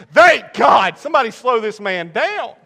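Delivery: loud, high comic desperation; exclaimed relief. Retold or imitated. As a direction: shout it out with hammed-up relief.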